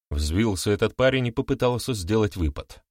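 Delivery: plain reading voice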